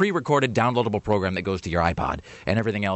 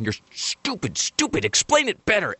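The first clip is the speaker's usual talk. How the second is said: self-scolding; 10 semitones higher than usual. Retold or imitated. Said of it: "in my own head going".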